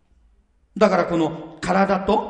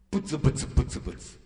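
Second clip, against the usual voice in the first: a voiceless, quieter mock grumble, fading off; muttered grumble; 6 semitones lower, 7 dB quieter, faster than usual. Preacher mimics a grumbling coworker, voiceless mock mutter. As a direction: murmur it under your breath like a sulky coworker.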